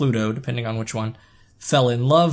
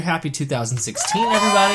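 cheerful loud new year greeting; excited shout; 5 semitones higher, 3 dB louder, much faster than usual. Real, not acted. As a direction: call it out to everyone.

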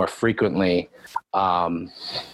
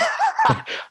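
high burst of laughter. Real, not acted. Laughing about coach.

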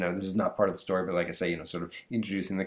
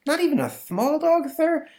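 put-on much higher, louder begging kid; pleading boy; 4 dB louder than usual. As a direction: beg dad for a tiny dog. Boy begs for dog.

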